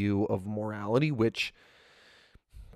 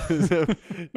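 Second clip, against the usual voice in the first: high loud laugh; loud burst of laughter; 8 semitones higher, 6 dB louder than usual. Real, not acted. Laughing at own idea.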